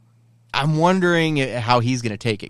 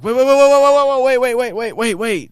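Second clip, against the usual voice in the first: very high rapid-fire stalling; a high burst that gradually loses volume; frantic retold plea; 10 semitones higher than usual. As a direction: mimic a panicked call to halt. Repeats Ezra's frantic plea.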